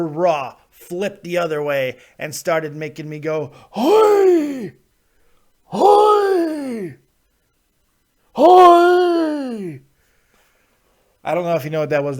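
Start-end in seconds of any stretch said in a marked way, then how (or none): 3.7-9.8 high, loud, drawn-out cry of Hoi. Retold or imitated. Host voicing Raw making him go Hoi, high loud cries. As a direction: belt out Hoi like an old man kvetching.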